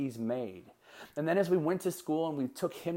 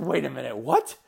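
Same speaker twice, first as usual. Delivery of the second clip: louder, startled double-take on "what"; mock surprise; 6 dB louder than usual. Dad reacting to son's answer, surprised. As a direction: act taken aback, voice jumping up. Acting his surprised reply to son.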